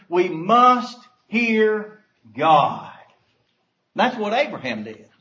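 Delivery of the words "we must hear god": "must" loud, phrase dragged out slowly; stress on must, slow. Shown as a demonstration. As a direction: lean heavily on "must", very slow. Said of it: "and underline that word".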